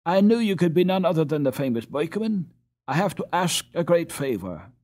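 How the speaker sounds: high, loud, eager plea; urgent appeal. A narrator's reading. Boy urgently addresses the doctor.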